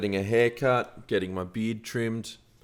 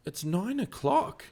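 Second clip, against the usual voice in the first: exasperated rant; 11 semitones higher, 4 dB louder than usual. Retold or imitated. To the late delivery driver, high indignant rant. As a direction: build the outrage, pitched way up. Retold angry complaint to driver.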